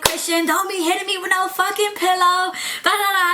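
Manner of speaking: high, heated telling-off; ratchet yelling. Retold or imitated. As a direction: go off on him, worked up. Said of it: "I'm getting real ratchet".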